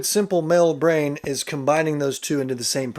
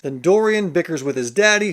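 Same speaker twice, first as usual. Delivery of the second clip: ordinary talk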